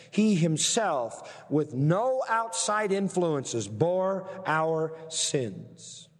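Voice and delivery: "He himself" stressed, "bore our sins" stretched out very slowly; emphatic, very slow. Shown as a demonstration. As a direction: spell it out word by word for weight. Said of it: "That's the key".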